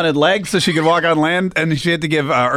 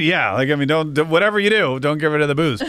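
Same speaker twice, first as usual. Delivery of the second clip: usual speaking voice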